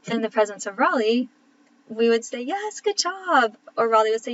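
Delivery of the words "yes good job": much higher, slow, sing-song "Yes, good job"; enthusiastic praise. Retold or imitated. Mom cheering Carly for saying Ra Ra, high slow sing-song. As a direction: stretch it out like cheering a toddler. Praising toddler for right name.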